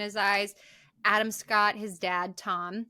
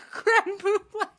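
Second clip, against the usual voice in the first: high, louder giggly words; laughing; 10 semitones higher, 6 dB louder, slower than usual. Real, not acted. Host laughs recalling mom holding dessert, giggling. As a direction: giggle through the dessert name.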